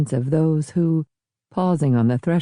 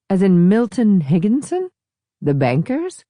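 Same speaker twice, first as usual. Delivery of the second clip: louder, raised, sudden interest; surprised recognition; 6 semitones higher, 3 dB louder than usual. Acted. Man in the bow to the others, surprised recognition. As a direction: catch the name with a jolt. Third man turns around.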